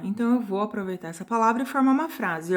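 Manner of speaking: normal talking voice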